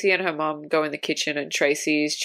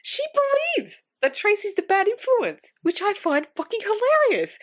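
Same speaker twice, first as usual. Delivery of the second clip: incredulous. Real, not acted. Incredulous rant about mum.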